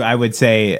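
ordinary talk, nothing marked

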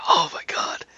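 mock-awed whispered exclamation; voiceless, breathy imitation of shock; imitated breathy gasp. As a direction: whisper it like a hammed-up reaction. Acting out Brain's reaction to iPad.

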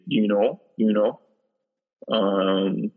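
ordinary talk, nothing marked

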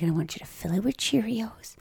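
soft whispered instruction; soothing whisper. Retold or imitated. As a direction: whisper it gently to calm her. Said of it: "She's like".

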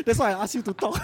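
breathless laugh through the words; tired, laughing while out of breath; laughing and panting. Real, not acted. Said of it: "Because I am tired".

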